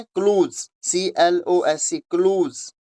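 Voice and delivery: क्लूज with a long oo, rhyming with टूज; patient teacher drill; pronunciation demo. Shown as a demonstration. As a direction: spell slowly, then say क्लूज. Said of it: "टूज की तरह".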